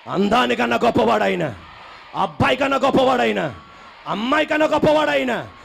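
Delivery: rebuking Satan, rising from hush to shout; building declaration. Shown as a demonstration. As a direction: climb from calm to a roaring proclamation. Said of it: "నువ్వు అనాలి".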